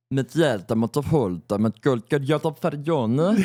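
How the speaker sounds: slow, sing-song made-up Swedish; fake Swedish. Shown as a demonstration. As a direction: babble in pretend Swedish. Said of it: "the Swedish one is kinda like".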